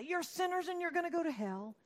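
high, loud mock hellfire preacher; hammed-up finger-wagging accuser; a parody of a harsh condemning preacher; mock condemnation. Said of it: "He doesn't say".

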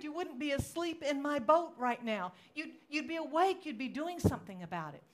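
high-pitched, loud reproach; acted-out indignant pleading, pressing each charge; accusing complaint. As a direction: protest bitterly at being left in trouble. Acts out accusing God in storm.

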